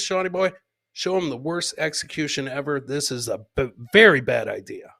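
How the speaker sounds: ordinary talk, nothing marked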